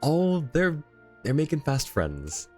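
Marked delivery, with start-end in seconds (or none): none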